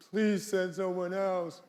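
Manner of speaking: put-on teenage whine. Moses as a teenager whining to God, mock whine. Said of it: "one big whine".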